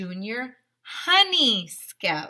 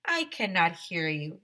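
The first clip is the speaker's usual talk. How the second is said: ordinary delivery, nothing marked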